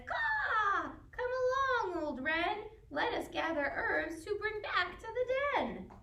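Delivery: high cawing raven voice; raven call. A narrator's reading. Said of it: "Raven says".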